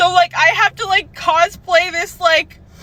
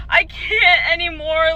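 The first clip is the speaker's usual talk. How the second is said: ordinary delivery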